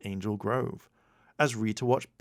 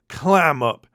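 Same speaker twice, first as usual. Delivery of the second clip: louder, snapping order to shut up; angry shout; 5 semitones higher, 11 dB louder than usual. A narrator's reading. Bulk shouting Skull down in irritation. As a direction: shut your pal up hard. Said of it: "he shouted at Skull".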